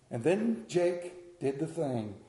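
ordinary delivery

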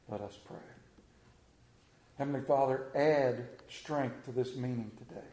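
low, soft prayer with long pauses; public prayer. Real, not acted. Preacher asking the Father for strength today, prayed low and soft.

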